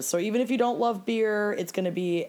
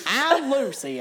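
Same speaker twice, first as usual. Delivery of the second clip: higher, hammed-up old prospector; old-timey prospector voice; 4 semitones higher than usual. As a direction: play a creaky old gold miner. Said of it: "pretending to be old-timey prospectors".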